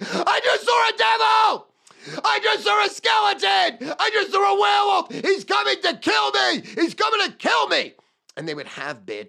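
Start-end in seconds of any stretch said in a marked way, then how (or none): 0.0-7.9 shrieking high and loud in panic; terrified screaming. Retold or imitated. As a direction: scream as if monsters are coming for you.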